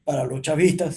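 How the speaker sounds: usual speaking voice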